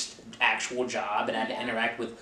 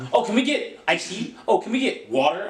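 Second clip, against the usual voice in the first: mimicking uppity guests; 7 semitones higher than usual. Mimicking demanding banquet guests.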